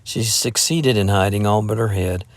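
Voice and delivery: usual speaking voice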